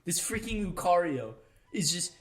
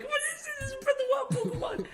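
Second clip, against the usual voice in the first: squealing very high in falsetto; falsetto freak-out. Retold or imitated. Gamer re-enacting his trailer reaction, falsetto freak-out. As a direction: let the voice fly up in fanboy disbelief. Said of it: "that's what I was like".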